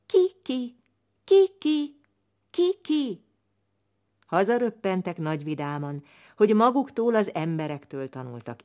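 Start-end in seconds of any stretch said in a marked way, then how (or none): 0.1-3.2 short high repeated calls; little owl's call. Acted. Little owl practising its new call, short and high. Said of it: "Addig tanulgatta, míg megtanulta".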